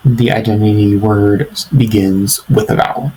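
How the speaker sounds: normal talking voice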